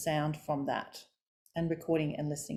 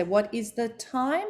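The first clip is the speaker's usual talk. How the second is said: "What is the time" climbing slowly word by word; rising pitch stairs; 4 semitones higher, slower than usual. Shown as a demonstration. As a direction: step slowly upward to a high "time". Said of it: "going up the stairs".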